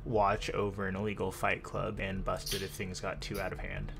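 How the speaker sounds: ordinary talk, nothing marked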